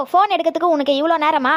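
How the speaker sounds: ordinary talk, nothing marked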